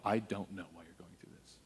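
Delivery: low, hushed and humble answer; gentle admission. Retold or imitated. Pastor answers someone in pain, gently and quietly. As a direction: drop low and soft, admitting it.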